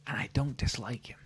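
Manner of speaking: soft unvoiced whisper; grudging whispered admission; whispered aside. Real, not acted. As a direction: concede it under your breath.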